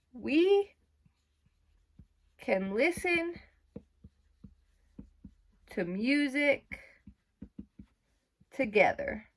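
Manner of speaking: spoken slowly word by word while writing; slow dictation. Shown as a demonstration. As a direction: drag each word out as you write it. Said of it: "I'm gonna write".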